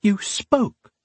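high, hushed amazement; breathed in astonishment. A narrator's reading. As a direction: say it in stunned wonder. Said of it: "she breathed".

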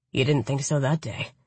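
low, smug lover; lightly voiced smug man. A narrator's reading. Khan voiced by narrator.

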